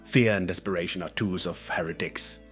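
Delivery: snapped retort in a put-on character voice; curt, stern rebuke from a zealot; mildly irritated snap. A narrator's reading. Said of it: "Gendry snapped".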